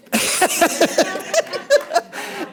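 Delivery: high, airy laugh. Real, not acted. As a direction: breaks up laughing. Wig joke on the course.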